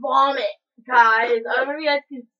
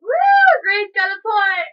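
high loud victory whoop; excited cheer; 7 semitones higher, 6 dB louder than usual. Real, not acted. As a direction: cheer for the point. Cheering a point in the game.

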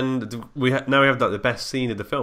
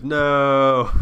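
Star Wars parody voice; 5 semitones higher, 9 dB louder than usual. Retold or imitated. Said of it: "and he goes".

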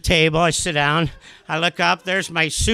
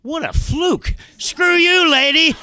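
high mock-triumphant crowing; gloating retort; 10 semitones higher, slower than usual. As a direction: crow at the heckler, rising to a high shout. Acted gloating retort to the heckler.

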